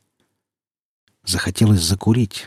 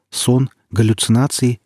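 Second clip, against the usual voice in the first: ordinary delivery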